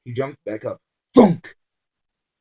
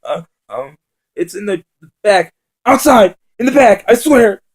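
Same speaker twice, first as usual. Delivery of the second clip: much higher, much louder frantic cry; panicked captive cries out the ship's location; terrified cry; 12 semitones higher, 17 dB louder than usual. Acted. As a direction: shriek out the location in terror. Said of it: "the scruffy man cried".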